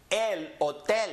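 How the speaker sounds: El hotel as el o-TEL, silent H; last-syllable stress. Shown as a demonstration. Teacher models a word ending in L, El hotel stressed on TEL. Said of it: "we're going up on the N".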